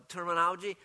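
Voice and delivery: normal talking voice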